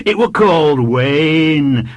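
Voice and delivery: high, loud, slow schoolboy voice; confident small boy. A narrator's reading. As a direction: announce the name like a child sure of it. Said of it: "the child told him".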